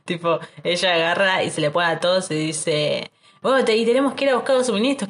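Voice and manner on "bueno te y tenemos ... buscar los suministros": higher, quick quoted pep talk; mock rallying speech. Ella les habla a todos los soldados, mock rallying. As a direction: rattle it off high, imitating a squad leader. Voices girl rallying the soldiers.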